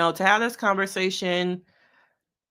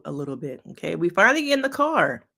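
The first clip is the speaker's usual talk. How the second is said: ordinary talk, nothing marked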